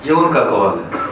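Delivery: ordinary talk, nothing marked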